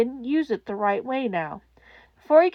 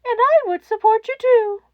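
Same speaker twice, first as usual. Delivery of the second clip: higher, cheerful lift; bright encouragement; 12 semitones higher, 7 dB louder than usual. A narrator's reading. Principal cheers on the child caller, brightly. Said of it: "Nezu said brightly".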